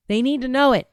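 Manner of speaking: ordinary delivery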